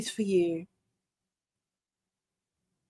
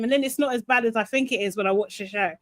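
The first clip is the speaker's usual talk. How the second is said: normal talking voice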